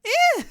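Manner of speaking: sharp high shriek; a scream of terror; terrified scream. Acted. Lotus breaking free and fleeing Ace, a high shriek. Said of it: "Another scream filled the room".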